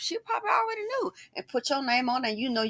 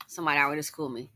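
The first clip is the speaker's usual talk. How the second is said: ordinary talk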